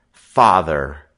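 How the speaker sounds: loud sudden low outburst; blurted out. A narrator's reading. Boy blurting his worry to his sister, loud and sudden. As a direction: let it burst out. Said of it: "he blurted out".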